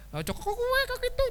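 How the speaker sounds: put-on high, sing-song Tegal Javanese accent. Preacher imitating a Tegal local's Javanese accent, high and sing-song. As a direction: tease in a thick Tegal Javanese lilt. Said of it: "kayak gitu loh".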